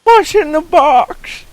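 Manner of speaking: high, loud drawn-out movie yell; shouted movie quote. Acted. Movie fan quoting the twist ending of Seven, hammed-up shout. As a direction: yell it like the film's big climax.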